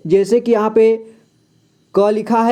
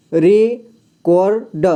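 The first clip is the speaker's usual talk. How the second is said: Record stretched very slowly, syllable by syllable; slow spelled-out reading; much slower than usual. Shown as a demonstration. Teacher sounding out record for a beginner, very slow. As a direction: drag out record so the child hears each part. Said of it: "को के बाद पढ़ना है".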